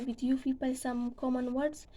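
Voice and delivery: ordinary talk, nothing marked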